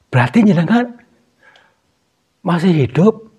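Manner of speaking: high, loud questioning; astonished. Real, not acted. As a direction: ask in sheer disbelief. Astonished she may be alive.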